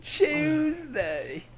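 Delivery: high drawn-out British Tuesday; hammed-up English accent. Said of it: "get a different accent".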